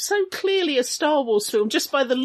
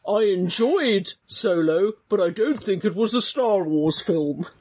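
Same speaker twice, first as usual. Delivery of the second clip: Keith impression; 3 semitones lower than usual. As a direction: mimic a friend's accent for laughs, slowed right down. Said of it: "It was my best accent".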